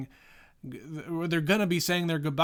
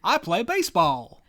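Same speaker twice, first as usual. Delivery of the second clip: put-on wholesome 1950s kid; 6 semitones higher, 5 dB louder than usual. Hitchhiker kid voiced in retelling.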